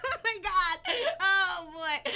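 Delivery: high, loud, laughing oh my God; flustered laugh. Real, not acted. Laughing at her own flub on stream, giddy and loud. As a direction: gush with giddy embarrassment. After flubbing the twister.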